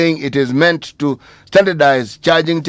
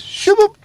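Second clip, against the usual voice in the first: high, quick mock whoosh; a squeaky vanishing noise; whooshing shoo-boop. Sound effect for investors leaving.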